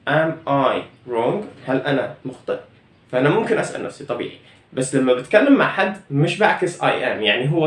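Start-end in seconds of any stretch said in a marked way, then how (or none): 0.1-1.4 Am I wrong stretched out word by word; slow careful classroom question; very slow teaching model. Shown as a demonstration. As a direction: drag out Am I wrong slowly for learners. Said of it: "فبقول".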